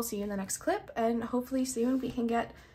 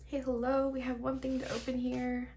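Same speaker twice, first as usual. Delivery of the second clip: ordinary delivery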